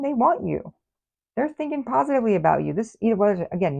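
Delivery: normal talking voice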